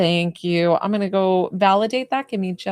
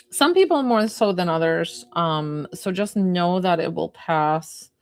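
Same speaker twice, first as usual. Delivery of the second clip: calm healing guidance; 4 semitones lower than usual. Real, not acted. Healer guiding a viewer through a live session, calm and low. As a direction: settle them gently like a guided healing.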